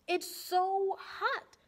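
slow, high complaint about the heat; overheated complaint. Acted. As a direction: drag it out, melting in the heat. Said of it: "said Keisha, fanning herself".

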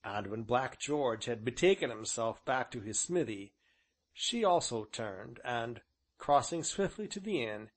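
plain reading voice

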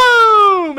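sudden high, booming shout; explosive boom. Acted. Preacher acting out fire falling from God, explosive shout. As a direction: burst out loud like a strike from heaven.

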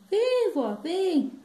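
high, loud coaxing call; clowns calling out. Acted. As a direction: whoop it out to coax Grandma up. Clowns join in calling granny.

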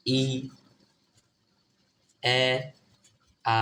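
ই, এ, আ as three isolated vowels, long pauses between; teacher slowly spacing out ই, এ, আ; demonstrating letter sounds. Shown as a demonstration. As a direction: model ই, এ, আ one sound at a time. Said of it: "ই এর উচ্চারণ".

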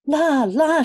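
high, loud startled maid's cry; chambermaid crying out. A narrator's reading. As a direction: shriek it like a flustered maid. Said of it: "she cried".

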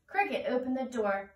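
usual speaking voice

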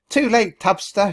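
high, loud gloating shriek. A narrator's reading. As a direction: shriek it in triumph. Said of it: "she shrieked".

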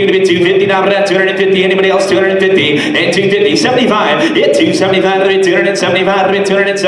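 rapid rhythmic auction chant; fast sing-song bid calling; auctioneer. Acted.

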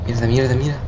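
muttered swearing; cursing under breath. Real, not acted. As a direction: curse softly to yourself. Swearing quietly after failing.

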